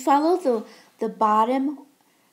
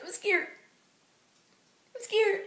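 mock scared; 10 semitones higher than usual. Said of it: "I'm like".

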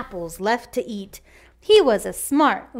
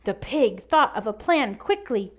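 ordinary delivery

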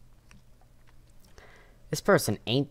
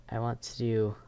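ordinary delivery

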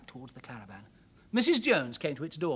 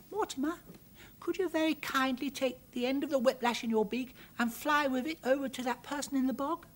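put-on high, coaxing little girl voice; persuading girl; 8 semitones higher than usual. Girl coaxing her bird to fly the whip. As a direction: sweetly coax the bird into helping. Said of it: "She spoke as persuadingly as she knew how".

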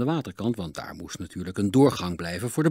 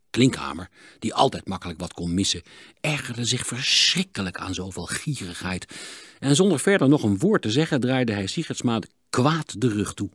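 ordinary delivery, nothing marked

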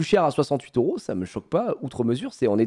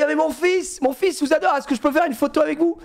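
imitated English-accented French, high-pitched; 11 semitones higher than usual. English mother asking for a photo, imitated high English accent. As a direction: put on an eager Englishwoman's accent. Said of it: "un accent anglais de fou".

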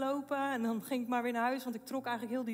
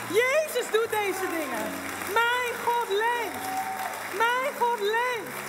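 higher, louder, slow preaching cry; full-force preaching; 7 semitones higher, 7 dB louder than usual. Real, not acted. Preacher proclaiming to the congregation that God lives, high and loud. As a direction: proclaim it to the whole room.

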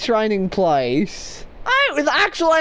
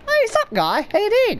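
mock friendly greeting; 8 semitones higher than usual.

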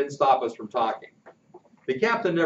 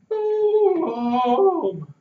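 groaning; 7 dB louder than usual. Acted. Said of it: "Pudge groaned".

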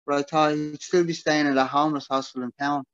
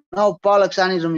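shouted higher and louder; 6 semitones higher, 6 dB louder, faster than usual. A narrator's reading. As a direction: yell it as the door slams. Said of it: "he shouted".

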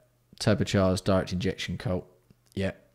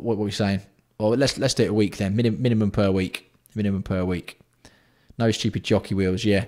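ordinary delivery

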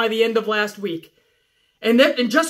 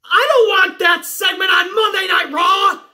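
loud, higher yelled rant; angry shout; 6 semitones higher, 3 dB louder than usual. Real, not acted. Fed-up host yelling at fans online, furious shout. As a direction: blow up at the audience.

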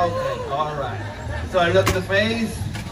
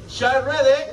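calling out; 10 semitones higher, 6 dB louder, slower than usual. Acted. Calling out to the crowd.

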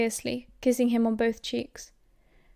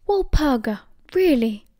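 raised, falling exclamation of shock; shocked gasp; 3 semitones higher, 5 dB louder than usual. A narrator's reading. Father protesting at a silencing charm, shocked gasp. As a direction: gasp it out in appalled disbelief. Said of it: "Mister Potter gasped".

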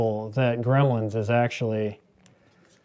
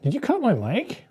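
higher indignant question; 9 semitones higher than usual. Real, not acted. To Summer who cut the mic, higher indignant outrage. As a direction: call out in mock outrage.

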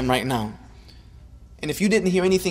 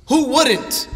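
emphatic rhetorical question; 8 semitones higher than usual. Real, not acted. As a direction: call "Who wouldn't?" out to the whole hall. Rhetorical question, repeated louder.

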